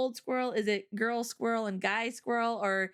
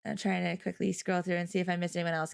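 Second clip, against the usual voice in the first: ordinary talk, nothing marked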